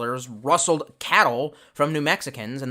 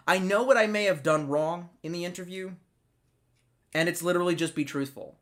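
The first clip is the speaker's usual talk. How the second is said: ordinary talk, nothing marked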